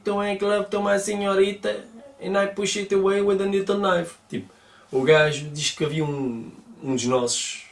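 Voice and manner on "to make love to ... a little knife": put-on higher, slow broken English with a Mexican accent; imitating a Mexican stumbling through English; mock Mexican English.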